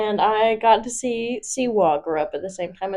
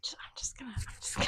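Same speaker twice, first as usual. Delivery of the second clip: breathy whisper; barely audible whisper; 24 dB quieter, faster than usual. Real, not acted. Whispering reply.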